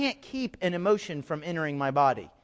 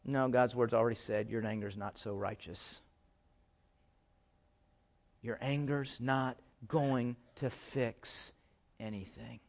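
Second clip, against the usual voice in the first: much lower and softer, slowing right down; hushed rebuke; 7 semitones lower, 10 dB quieter than usual. Acted. Pastor quietly correcting the congregation's anger, soft and slow. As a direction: pull it back to a near hush. Drops voice after loud build.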